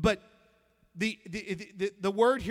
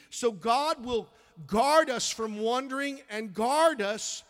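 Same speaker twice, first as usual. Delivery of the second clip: preaching; 2 semitones higher, 4 dB louder than usual. Real, not acted. Pastor preaching to the congregation, fervent. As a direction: proclaim it with fire.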